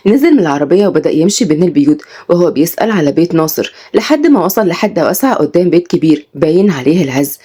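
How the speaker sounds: usual speaking voice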